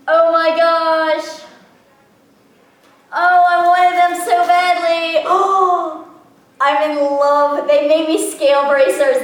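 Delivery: high, loud, drawn-out squeal of delight; overjoyed. Real, not acted. Unboxer finds the bracers she wanted so badly, overjoyed. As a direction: gush at full volume over a wished-for gift. Unwraps wished-for scale bracers.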